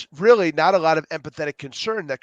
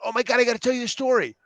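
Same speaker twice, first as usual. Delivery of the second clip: fast, high and eager; bursting to tell a story; excited friend; 8 semitones higher, much faster than usual. Retold or imitated. Friend gushing to another friend, fast excited voice.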